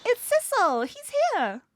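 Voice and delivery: loud, high happy shout; excited cheer. Acted. Child greeting Sissel's arrival, loud excited cheer. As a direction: cheer like a kid seeing help arrive.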